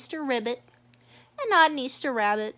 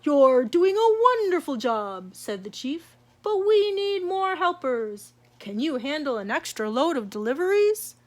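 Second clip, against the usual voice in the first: chief Easter Bunny; 2 semitones higher, 4 dB louder than usual. Acted. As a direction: speak like the boss bunny. The chief speaks.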